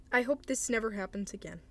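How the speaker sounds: ordinary talk, nothing marked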